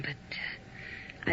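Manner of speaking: whisper. Acted. Caller flirting over the phone.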